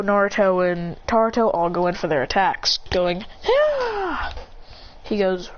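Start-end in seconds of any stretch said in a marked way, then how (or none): 3.5-4.3 very high charging cry; high sustained yell without extra volume; attack yell. Acted. Three fighters going in for attacks, yelling.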